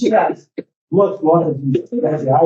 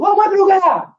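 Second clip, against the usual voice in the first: imitated shout; 13 semitones higher, slower than usual. Man hailing the shopkeeper from a car, imitated shout. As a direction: mimic someone hailing a friend. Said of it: "aí o cara gritou".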